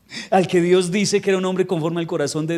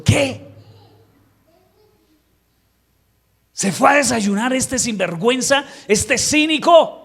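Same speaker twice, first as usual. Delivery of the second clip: much higher, louder indignant cry, slowing at the end; outraged disbelief; 8 semitones higher, 4 dB louder, slower than usual. Retold or imitated. Preacher reacting to David going to breakfast, higher indignant disbelief. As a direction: gasp at the nerve of him.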